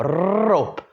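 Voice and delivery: rope with a long trilled R; rolled R. Shown as a demonstration. Teacher drilling students on a long rolled R in rope. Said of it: "how long can you pull that R".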